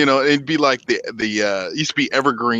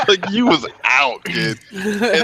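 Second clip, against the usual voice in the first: laughing; 8 semitones higher than usual. Real, not acted. Laughing at Danny passing out.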